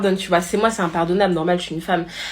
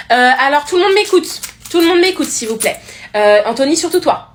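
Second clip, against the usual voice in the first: loud, high classroom call; teacher calling for attention; 4 semitones higher, 4 dB louder than usual. Acted. Teacher telling the whole class to listen, loud and insistent. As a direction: raise your voice over a chatty class.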